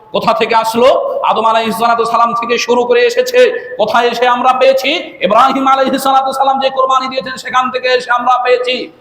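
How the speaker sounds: high, ringing question-and-answer sermon voice; forceful preaching. Real, not acted. Preacher tracing the origin of sacrifice for listeners, forceful preaching.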